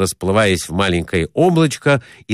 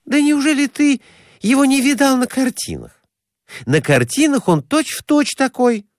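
high-pitched incredulous woman; incredulous Glafira Semyonovna. A narrator's reading. Woman asking companion about pictures of Vesuvius, incredulous. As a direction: question him in a high woman's voice.

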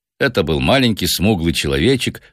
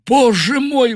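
high-pitched exclamation of dismay; panicked professor's outcry; shocked and frightened; slower than usual. A narrator's reading. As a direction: cry out in alarm. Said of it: "Профессор был поражён и напуган".

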